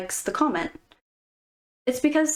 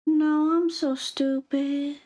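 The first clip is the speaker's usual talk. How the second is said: put-on slow, higher whiny girl voice; mock self-pity; 4 semitones higher, slower than usual. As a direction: drag it out like a fishing-for-compliments girl.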